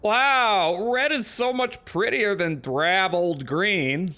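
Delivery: high, drawn-out, hammed-up amazement; childlike wonder. Player answers the container painter, playful childlike wonder. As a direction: gush with exaggerated wide-eyed awe.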